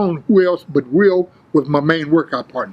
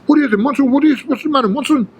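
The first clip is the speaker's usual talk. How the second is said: higher, agitated imitation of the desk guy; Julio from Belize; 7 semitones higher, faster than usual. Desk guy answering the lifter's call, imitated voice. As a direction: imitate him answering in a raised pitch. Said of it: "he come back, Julio from Belize".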